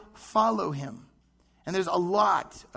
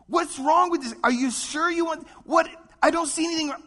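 higher and louder, baffled; pleading Pilate; 9 semitones higher, 4 dB louder than usual. Retold or imitated. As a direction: protest like a puzzled governor. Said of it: "how many times did Pilate try".

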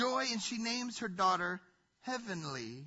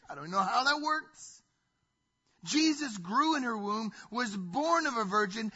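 ordinary delivery

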